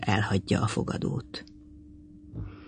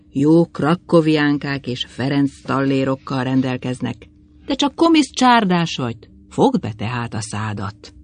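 plain reading voice

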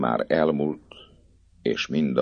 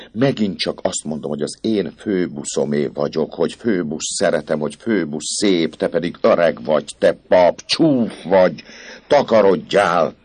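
higher, louder scornful defiance; defiant girl; 4 semitones higher, 6 dB louder than usual. Acted. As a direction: throw it in his face. Esmeralda defies the priest. (dacos, gúnyos, hangos lány)

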